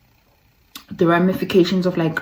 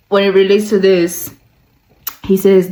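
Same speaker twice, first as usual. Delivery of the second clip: normal talking voice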